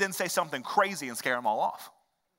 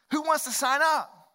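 mock cheery recruiter; 8 semitones higher than usual. Mock recruiter asks for sign-ups.

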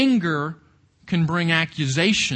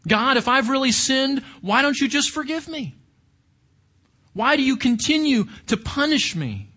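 higher-pitched, pleading protest as Job; crying out to God; 7 semitones higher than usual. Acted. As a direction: plead with God in a raised, dramatized voice. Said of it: "he cries out to God".

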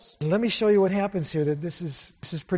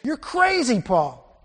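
mock outburst; 7 semitones higher, 6 dB louder, much slower than usual. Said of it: "Can you picture the passion here?".

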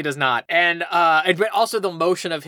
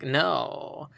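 imitating cool guy; 3 semitones lower, 7 dB quieter than usual. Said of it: "that's how he talks like this".

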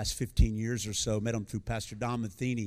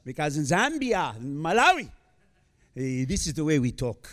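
preacher slipping into a Zambian-style African English accent. Shown as a demonstration. Preacher showing the Australian congregation the African English accent he picks up. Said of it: "talk like this".

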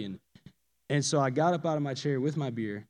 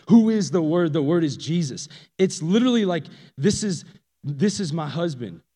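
ordinary talk, nothing marked

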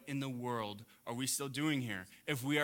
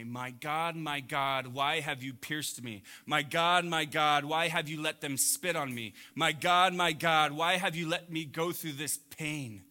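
building louder with each cry of my God; pleading lament growing louder; mounting anguished cry; 6 dB louder than usual. Acted. Mock lament of Jesus on cross.

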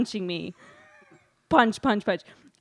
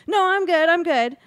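higher and louder, brushing it off too brightly; fake reassurance; 8 semitones higher, 6 dB louder than usual. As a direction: insist you're fine when you're not. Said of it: "I'm like".

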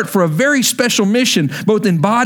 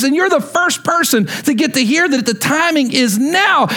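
excited proclamation; 7 semitones higher than usual. Retold or imitated. Retells the angel's excited message.

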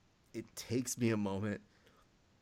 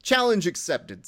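louder, higher, cocky reply; mock XFL voice; 8 semitones higher, 8 dB louder than usual. Joke line read as the XFL accepting the challenge, cocky. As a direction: say it like a dare taken up. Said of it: "said the X F L".

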